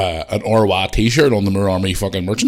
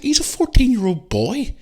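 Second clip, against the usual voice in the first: high-pitched indignant tirade; real anger at online critics; furious rant; 12 semitones higher, slower than usual. Real, not acted. Furious defence of his son.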